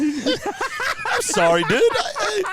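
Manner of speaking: high, slow, laughing through the words; laughing apology. Real, not acted. Billy apologizing for mixing up the races, laughing high and slow. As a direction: apologize while you can't stop laughing.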